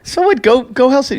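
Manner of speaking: pushy retort. Retold or imitated. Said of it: "and I go".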